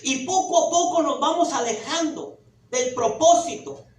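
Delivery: fiery pulpit preaching; loud soapbox ranting. Acted. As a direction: preach it hard to the congregation. Preaching at full force.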